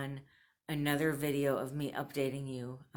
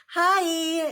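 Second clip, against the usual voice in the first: a much higher, louder chirpy hello; mock bubbly phone greeting; cheerful rep voice; 14 semitones higher, 12 dB louder than usual. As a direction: chirp it like a call-centre rep. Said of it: "to then call all cheerful".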